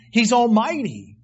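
ordinary talk, nothing marked

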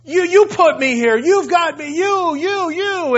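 whining complaint. Retold or imitated. Believer whining to God that it's his fault. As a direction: complain like a sulky child blaming someone. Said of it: "I do it all the time".

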